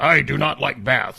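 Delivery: lightly voiced stern alien warrior; deadpan warrior declaration, played for laughs; Worf impression. Worf answering Wolverine, lightly acted. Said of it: "I just want you to know that Worf".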